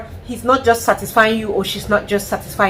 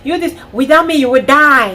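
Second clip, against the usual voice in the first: much higher, louder taunting husband; mock abusive husband; 7 semitones higher, 5 dB louder than usual. Imitating a manipulative husband, mocking taunt. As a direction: belittle her as the abuser. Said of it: "You're just".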